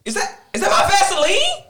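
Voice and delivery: high, loud outraged mom; angry mom. Retold or imitated. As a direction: shriek at the mess like a furious mom. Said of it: "She was like".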